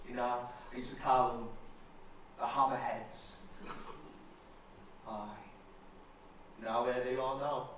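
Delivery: low, wistful remembering; mild heartache. Acted. Old fitter recalling his cranes now gone to India, wistful. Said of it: "It's me hard thinking about it".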